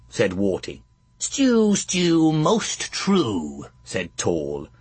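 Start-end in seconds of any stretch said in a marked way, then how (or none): 1.2-3.7 high cackling crone voice; sing-song witch. A narrator's reading. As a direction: chirp it like a hag.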